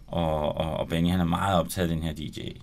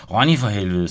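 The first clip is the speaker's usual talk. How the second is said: angry; 2 semitones higher, 7 dB louder than usual. Acted. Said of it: "sagde Frede vredt".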